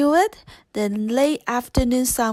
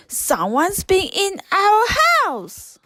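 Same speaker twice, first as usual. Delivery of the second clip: high, put-on dwarf voice, alarmed; a dwarf crying out that someone's been inside; startled little-man voice discovering an intruder; 8 semitones higher than usual.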